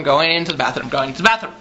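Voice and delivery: dragging steps, voice sinking low. Acted. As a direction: let the phrase sink down in pitch.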